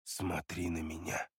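soft, low, firm command; quiet but insistent, demand his gaze; calm and commanding. A narrator's reading. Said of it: "произнёс спокойным, но преисполненным уверенности требовательным голосом".

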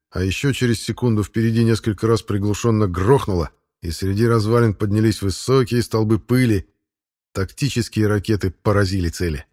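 ordinary delivery

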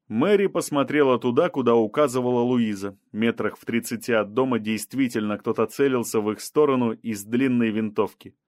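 plain reading voice

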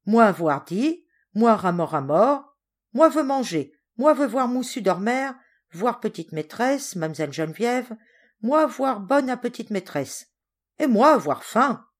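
the big sailor asking boldly for food; pidgin-speaking sailor. Acted. The sailor Ramor asks the servants for food, lightly voiced.

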